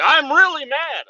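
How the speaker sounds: hammed-up protest that he really is mad; mock angry. Coworker insists over the phone he is truly mad, mock angry. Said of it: "acting mad".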